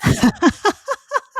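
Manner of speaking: bright burst of laughter; high laugh. Real, not acted. Laughs at soothing voice joke.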